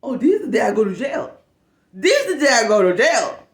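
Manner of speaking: furious disbelief. Retold or imitated. Reliving her fury.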